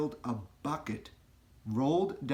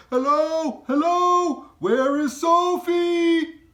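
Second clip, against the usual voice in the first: high, loud calling, dragging out the last words; shouting parents; 13 dB louder, much slower than usual. A narrator's reading. Giant imitates parents calling for Sophie, high and loud. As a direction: call out through the house for a lost child.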